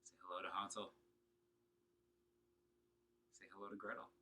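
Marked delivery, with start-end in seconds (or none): none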